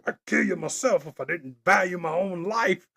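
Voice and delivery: lightly voiced disgusted villain, leaning on "value"; a hint of a scornful movie villain; villain quote again. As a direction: savour the villain's line. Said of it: "funniest thing".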